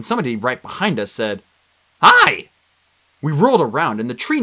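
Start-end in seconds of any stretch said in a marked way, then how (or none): 2.0-2.5 bright, much higher, louder call; cheerful hi. A narrator's reading. Tree nymph popping up behind the heroes, chirpy. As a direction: pop up out of nowhere with a chirpy hi.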